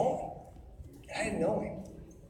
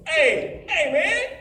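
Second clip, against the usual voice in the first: put-on higher, louder teasing call to the kid; playful call; 8 semitones higher, 7 dB louder, much slower than usual. Dad calling his little son again, higher and louder. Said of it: "I'd go".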